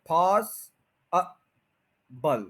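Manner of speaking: पॉस- अ- बल said apart; पॉस, schwa, बल separated; syllable split. Shown as a demonstration. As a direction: break पॉसिबल into its syllables. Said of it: "उसके बाद श्वा sound है".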